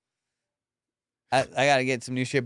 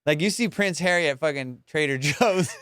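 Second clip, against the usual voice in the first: ordinary talk, nothing marked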